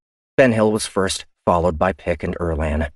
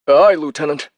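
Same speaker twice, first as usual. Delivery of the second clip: short higher acknowledgment; crewman on comm; 9 semitones higher, 7 dB louder than usual. Acted. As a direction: snap back a quick aye.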